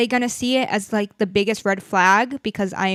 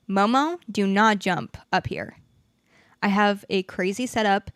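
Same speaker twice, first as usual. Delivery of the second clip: normal talking voice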